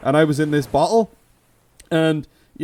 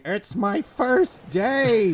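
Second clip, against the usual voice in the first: high, drawn-out singsong excuse; novice's excuse; 8 semitones higher, much slower than usual. Acted. Host imitating a novice djinn excusing himself, high and slow. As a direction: stretch it out like a hapless new starter.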